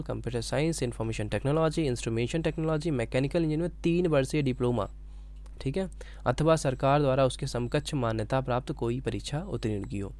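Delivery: usual speaking voice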